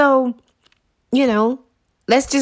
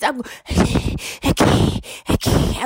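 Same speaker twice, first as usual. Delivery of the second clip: airless, voiceless gasps; wheezing can't-breathe bit; gasping for air. Acted. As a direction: fight to get words out without breath. Gasping attempts to speak.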